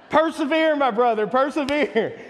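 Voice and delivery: rally him to hang in there; mock rallying cheer. Real, not acted.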